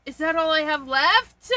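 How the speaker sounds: ordinary talk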